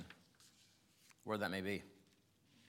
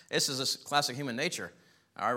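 normal talking voice

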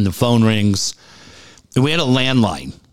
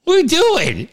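very high mock child's whine; high, exasperated kid impression; kid yelling at dad; slower than usual. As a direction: imitate a little boy scolding his dad. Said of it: "yelling at me".

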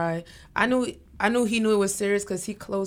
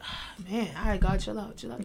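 hushed self-talk; 8 dB quieter than usual. Retold or imitated. Retold talking herself down, laughing.

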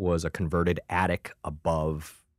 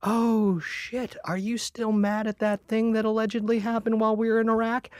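much higher, guilty, sheepish voice; sheepish question. Retold or imitated. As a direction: ask it like a guilty kid. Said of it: "I'm like".

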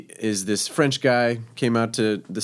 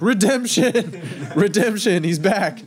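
high-pitched jubilant announcement; mock triumphant cheer; 10 semitones higher, 3 dB louder, slower than usual. Sous chef celebrating cook's approval, mock triumphant cheer. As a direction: imitate a sous chef proclaiming a comeback.

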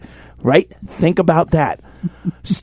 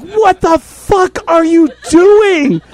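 high, loud and slow, appalled; shocked yell; 8 semitones higher, 5 dB louder, slower than usual. Retold or imitated. Teller yelling at his partner, appalled and loud. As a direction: yell at her to stop. Watching her overdo the ointment.

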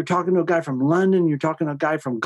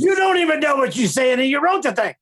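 mock shouting quote; 9 semitones higher than usual. Retold quote, shouted high.

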